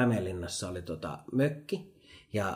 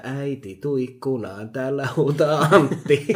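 put-on singsong child's call; 4 semitones higher, 8 dB louder than usual. Child calling mum to the window from the courtyard, singsong shout. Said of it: "samalla niinku melodialla".

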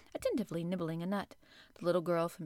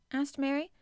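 ordinary delivery, nothing marked

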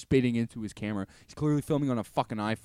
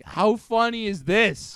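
higher, louder delighted question; gleeful; 8 semitones higher, 7 dB louder, slower than usual. Retold or imitated. Coworker on the phone, gleefully asking. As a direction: crow over it like it's hilarious. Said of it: "he was like".

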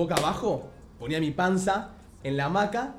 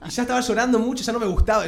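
worked up; 8 semitones higher, 5 dB louder, faster than usual. Real, not acted. Reliving child crying.

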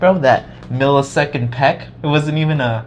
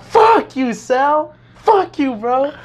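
high-pitched indignant retort, hammed up; mock outraged cursing; much slower than usual. Teller acts out cursing the friend at the dance, mock outrage. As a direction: curse him out in a raised, high voice.

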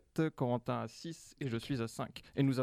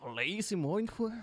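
put-on Belgian accent; 4 semitones higher than usual. Belgian chef answering the inspector, mock Belgian accent. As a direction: caricature Belgian accent, higher pitch. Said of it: "le chef de cuisine belge".

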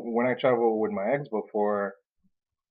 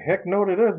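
higher, louder, indignant no; flat refusal; 9 semitones higher, 5 dB louder than usual. Retold or imitated. To partner about the trip itinerary, an indignant refusal. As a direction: reject the plan outright.

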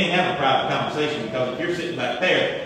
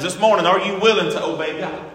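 normal talking voice

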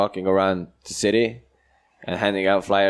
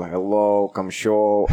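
hammed-up Estonian-Russian accent; thick mock Estonian accent, few words; bad Estonian-Russian accent; slower than usual. Comedian flyering strangers, worst Estonian-Russian accent. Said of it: "do the worst Estonian".

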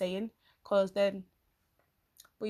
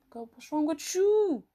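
exasperated; 6 semitones higher, 5 dB louder, slower than usual. Retold or imitated. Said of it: "I'm like".

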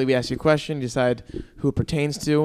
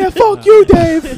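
very high, loud shout; joking shout at the father; yelling; 13 dB louder, much slower than usual. Real, not acted. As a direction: yell it defiantly at the dad. Yelling at absent dad.

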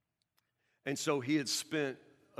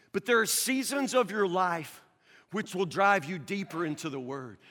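ordinary talk, nothing marked

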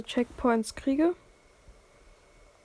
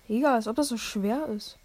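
usual speaking voice

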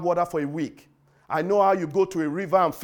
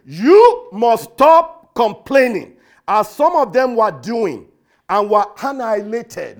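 slow and loud, every word a command; stern authoritative voice; 8 dB louder, slower than usual. Retold or imitated. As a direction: thunder it out as Paul's stern warning. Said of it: "in the most stern voice, authoritative tone".

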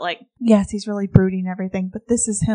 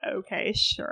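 sarcastic whisper; 7 dB quieter, slower than usual. Retold or imitated. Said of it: "And she's like".

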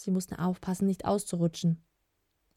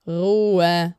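commanding shout; 8 dB louder than usual. A narrator's reading. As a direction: silence the room with one drawn-out shout. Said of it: "mit der tiefen Stimme ließ die anderen verstummen".

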